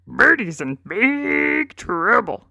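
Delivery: a warthog grunting out bad news; storybook warthog with a snort. A narrator's reading. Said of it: "A warthog named Hamhocks snorted".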